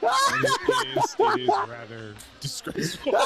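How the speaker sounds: high, loud posh gentleman voice; hammed-up prim British disapproval; posh mock voice.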